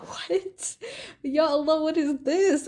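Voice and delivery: can't believe all the tests are fine; baffled disbelief. Retold or imitated. In the hospital after clean results, stunned disbelief.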